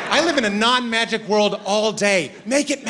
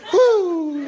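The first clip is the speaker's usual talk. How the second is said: high, half-hearted impressed woo; forced mild woo; 9 semitones higher, 3 dB louder than usual. Retold or imitated. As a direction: fake being wowed. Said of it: "you just have to be like".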